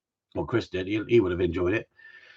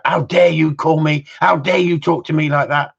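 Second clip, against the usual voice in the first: mock indignant; 7 semitones higher, 4 dB louder than usual. Voicing the offended visitor.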